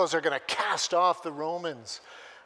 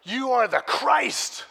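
bold declaration; 7 semitones higher, 7 dB louder, slower than usual. Acted. Peter declares Jesus is Christ.

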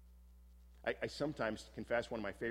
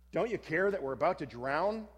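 higher, louder panicked plea; frantic shout; 6 semitones higher, 7 dB louder than usual. Acted. Disciple shaking the sleeping Jesus awake, frantic shout. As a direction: shout it in terror over the storm. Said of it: "frantically shaking him and shouting".

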